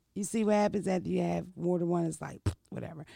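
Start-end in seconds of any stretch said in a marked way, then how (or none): none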